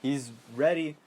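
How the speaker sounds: normal talking voice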